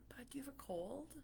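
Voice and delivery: whispered gentle question; hushed caring whisper; soft whisper. Retold or imitated. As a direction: whisper softly to a sick child. Asking sick son gently.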